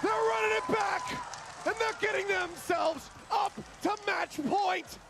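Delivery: high, loud, slow hype call; hyped caster shout. Real, not acted. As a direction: roar the match point call. Caster hype at match point.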